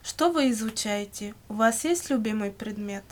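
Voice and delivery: ordinary delivery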